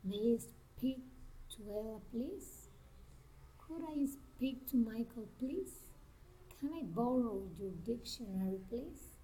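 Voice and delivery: polite requests with "please" lifting; careful requests, voice going up on "please"; rising intonation. Shown as a demonstration. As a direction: let each "please" rise softly at the end. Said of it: "rising intonation".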